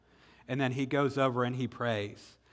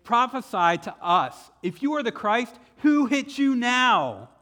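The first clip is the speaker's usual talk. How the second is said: high, loud jeering taunt; mocking loud challenge, slowing at the end; taunting soldiers; 9 semitones higher, 7 dB louder than usual. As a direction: sneer at the blindfolded prisoner. Soldiers taunt the beaten Jesus.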